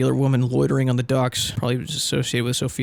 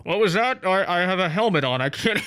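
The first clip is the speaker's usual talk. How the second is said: mock deafness; 4 semitones higher than usual. Hero pretending his helmet muffles her whisper. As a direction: play dumb about the whisper.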